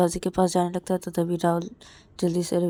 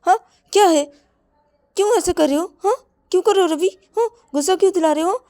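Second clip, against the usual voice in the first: very high, loud, fast yelling; shrill screaming rant; furious shouting; 11 semitones higher, 10 dB louder than usual. Acted. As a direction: explode at him. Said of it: "बोलता कि".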